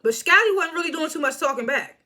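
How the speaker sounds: ordinary talk, nothing marked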